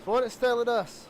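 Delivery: excited high race call; motorsport commentator. Real, not acted. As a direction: call the close finish with energy. Calls the photo finish.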